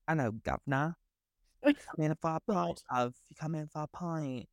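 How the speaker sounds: imitating an American doing a mock English accent; a lightly put-on English pub-landlord accent; fake English accent. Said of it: "putting on an English accent".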